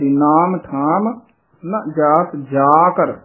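ਜਾ ਕਰ drawn out as two parts, slowly; slow, stretched ਨਾ ਜਾਤ ਜਾ ਕਰ; drawn-out recitation. Shown as a demonstration. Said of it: "ਲਮਕਾ ਕੇ ਬੋਲਣਾ ਹੈ".

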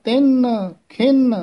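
ਤਿਨ and ਖਿਨ sung out high; pronunciation demo. Shown as a demonstration. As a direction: lift ਤਿਨ, ਖਿਨ up sharply. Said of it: "ਫਿਰ ਬੋਲਣਾ ਹੈ".

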